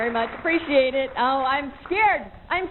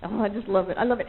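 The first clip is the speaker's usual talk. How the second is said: usual speaking voice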